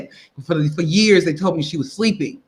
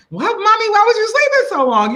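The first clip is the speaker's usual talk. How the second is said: put-on much higher, bright little-girl question; child asking mommy; 14 semitones higher, 3 dB louder than usual. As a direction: innocent child talking to mother. Child greeting waking mother.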